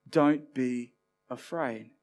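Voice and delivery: slow, soft "don't be afraid"; slow down and soothe the listener; gentle comfort. Pretend. Jesus soothing scared disciples on the lake, slow and soft.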